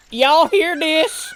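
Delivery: high, loud, slow Southern drawl. Retold or imitated. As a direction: belt it out like a backwoods Southerner. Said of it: "Southern gentlemen ... and there's, like".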